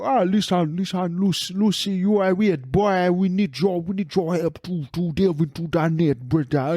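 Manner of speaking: hammed-up Voodoo Boys accent; comic put-on Voodoo Boys accent. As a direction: imitate a gang member in a thick Voodoo Boys accent. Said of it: "for the Voodoo Boys. They go".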